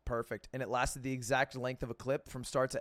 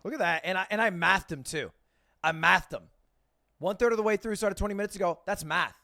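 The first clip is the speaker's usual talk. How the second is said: excited bragging; 7 semitones higher, 6 dB louder than usual. Real, not acted. Bragging about doing math.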